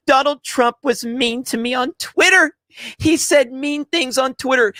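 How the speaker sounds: high mocking singsong of a fragile critic; mock their sweet little soul whining; mocking whiny voice. Streamer mocking Trump critics in a high whiny voice.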